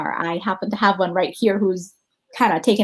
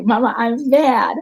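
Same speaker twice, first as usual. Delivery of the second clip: slow, louder mock-mad child; imitating her toddler; 2 semitones higher, 7 dB louder, slower than usual. Mom quoting her daughter, slow, louder, mock mad. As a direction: plant your feet like an upset little kid. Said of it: "My little one just started to say".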